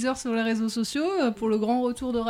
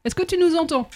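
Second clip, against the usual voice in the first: raised voice, calling to a bad line; 5 semitones higher, 5 dB louder than usual. Real, not acted. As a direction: call out to be heard. Calling to caller on phone.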